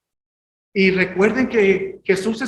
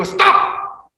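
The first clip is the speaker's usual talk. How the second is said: sharp "Stop!"; 11 semitones higher, 5 dB louder than usual. Shown as a demonstration. Preacher showing how he tells himself to stop. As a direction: snap out "Stop!" high and loud.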